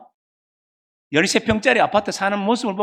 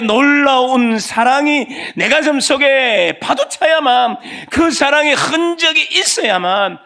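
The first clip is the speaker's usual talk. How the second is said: louder and higher, slow and ringing; preaching at full force; 9 semitones higher, 6 dB louder, slower than usual. Real, not acted. Preacher proclaims God's love to the congregation, loud and slow. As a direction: preach the cross with all your strength.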